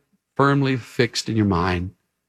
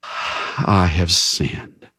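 slow, low, sighing admission; a touch of a sighing king admitting guilt; sighing confession; 8 semitones lower, much slower than usual. A narrator's reading. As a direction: let it out with a heavy sigh. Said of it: "says with a sigh".

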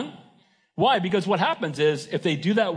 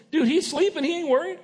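higher, offhand buddy voice pointing at sleeping Jesus; casual disciple; 10 semitones higher than usual. Retold or imitated. Disciples to each other about sleeping Jesus, higher and offhand. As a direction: toss it off like a relaxed fisherman. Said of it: "looked at the Lord and said".

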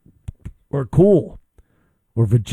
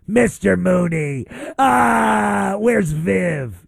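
hammed-up sitcom wail; drawn-out comic bawl, higher in the middle; Lucille Ball; 4 semitones higher, much slower than usual. Host teasing caller, hammy sitcom comedienne impression. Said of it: "that certain Lucille Ball tone to your voice".

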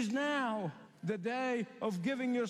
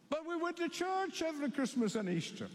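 much higher, begging tone; pleading foolish ones; 7 semitones higher than usual. Acted. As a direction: plead desperately at the closed door.

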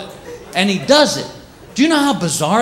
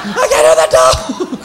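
panicked yell; 12 semitones higher, much slower than usual. Retold or imitated. Visitor panicking at the silent dog, shrieking high. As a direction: shriek as if suddenly deaf.

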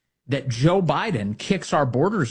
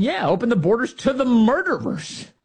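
mocking higher voice; sarcastic cheerful quote, pitched up; mock Elijah Manley; 9 semitones higher than usual. Host mocks Elijah Manley, higher sarcastic voice. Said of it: "Elijah Manley saying".

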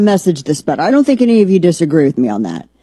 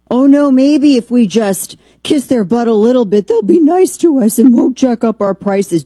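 higher and louder, sneering mock of the appeasers; mocking whine; 5 semitones higher, 3 dB louder than usual. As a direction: whine it like a spineless pleader.

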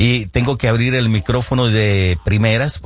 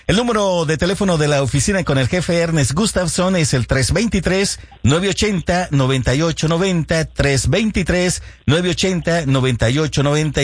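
phone number read out; 5 semitones higher than usual. Shown as a demonstration. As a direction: read the number out clearly for listeners. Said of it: "reads office phone number twice".

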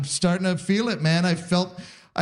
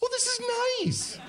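mock delight; 6 dB quieter than usual. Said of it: "And I was like".

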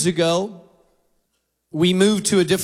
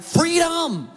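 high gleeful shout of freedom; dog's joyful cry; 8 semitones higher than usual. Retold or imitated. Pastor voicing the escaping dog, high and gleeful.

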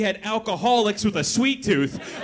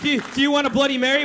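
ordinary delivery

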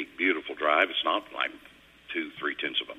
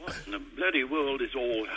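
higher-pitched imitation of a flustered Brit; mock British; 6 semitones higher than usual. Imitating Brits complaining about the heat, mock British exasperation. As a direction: put on a hammed-up British grumble. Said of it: "the Brits are going".

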